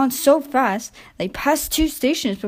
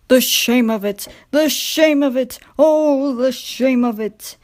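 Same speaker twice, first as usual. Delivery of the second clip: louder, wailing lament of shame; 3 semitones higher, 4 dB louder than usual. A narrator's reading. Proud Gordon pulling coal, louder wailing lament. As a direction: moan it as a wounded pride.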